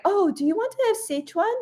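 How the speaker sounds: high, quick, eager invitation; mimicked suitor. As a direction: imitate a man keen to impress his date. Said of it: "he went like".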